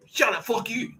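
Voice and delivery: high mock angry retort; mock angry snap. Boss snapping at the critic, mock angry. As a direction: snap back like a cornered boss.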